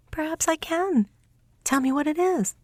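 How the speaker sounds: high, eager little mouse princess; high little mouse, fond and eager. Acted. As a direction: play the tiny mouse sweetheart, high and lively. Mouse answers Veiko.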